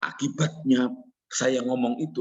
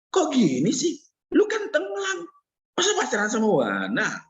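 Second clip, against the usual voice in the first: scolding parent; 9 semitones higher than usual. Retold or imitated. Voicing a disapproving Chinese parent.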